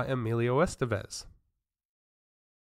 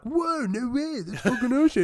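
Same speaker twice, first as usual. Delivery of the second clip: much higher, gushing fan voice; mock excitement; 12 semitones higher than usual. Imagining the friend's reaction, high mock excitement. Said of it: "you'd be like".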